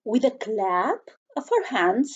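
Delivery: plain reading voice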